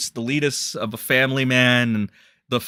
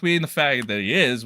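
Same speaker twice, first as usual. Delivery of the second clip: ordinary talk, nothing marked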